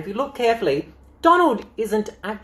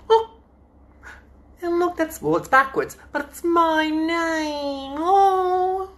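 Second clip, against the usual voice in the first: delighted squeal; 13 semitones higher, slower than usual. Real, not acted. Finding her childhood name in book.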